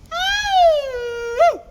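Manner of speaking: high, loud demo wolf howl. Shown as a demonstration. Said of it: "so you gotta go".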